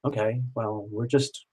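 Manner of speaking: low, soft, hesitant reply; tentatively, half under your breath; retold awkward, muted response; quiet hesitation. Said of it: "I'm just kind of like".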